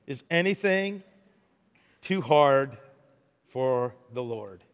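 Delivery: slow and deliberate, long pauses between phrases; slow, weighty question. Shown as a demonstration. Preacher putting God's question to the congregation, slow and weighty.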